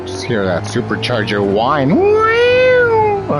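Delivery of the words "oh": a sudden very high squeal; surprised yelp. Real, not acted. Streamer squealing at the engine sound, sudden surprised yelp.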